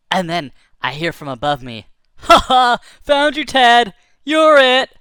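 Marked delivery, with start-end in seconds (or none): 2.2-4.9 loud, high, triumphant bird voice; gleeful hawk. Acted. Hawk above shouting at Ted, gleeful and loud. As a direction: crow it down from the sky like winning a game.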